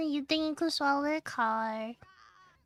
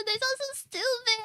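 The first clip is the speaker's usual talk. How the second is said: ordinary talk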